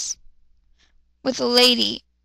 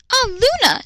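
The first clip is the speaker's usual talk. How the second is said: squealing greeting; 11 semitones higher than usual. Acted. Matsumoto greeting Luna at the door, high squeal. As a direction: greet her friend with delight. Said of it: "she squealed".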